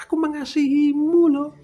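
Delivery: high, loud mock declaration of love; showy, high-pitched exclamation; professed love. Acting out an empty love declaration.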